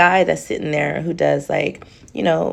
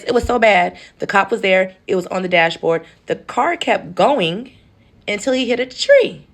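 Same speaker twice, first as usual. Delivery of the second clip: ordinary talk, nothing marked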